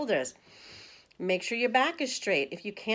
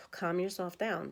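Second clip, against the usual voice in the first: softer, slower, after a sigh; soothing sigh; 7 dB quieter than usual. Shown as a demonstration. Teacher showing how to calm down when angry, soft and slow. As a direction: ease the words out gently. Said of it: "breathe in for three".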